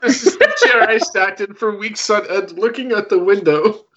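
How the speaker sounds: put-on gather the kids round like grandpa; rambling grandpa story.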